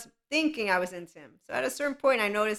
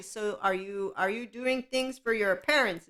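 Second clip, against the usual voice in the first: ordinary talk, nothing marked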